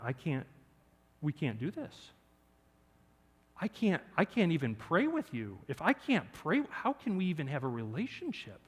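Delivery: hushed remorseful retelling; quiet heartbroken. Retold or imitated. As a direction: let the words fall out quietly, crushed. Quiet, crushed breakup words.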